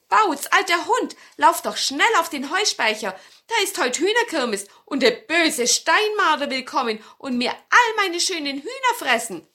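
put-on an old woman hurrying her dog along; grandmother urging. A narrator's reading. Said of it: "Und sie sagte ihm".

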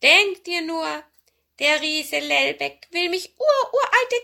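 high, loud meowing cat voice; high-pitched, plaintive talking cat; pleading old cat. Acted.

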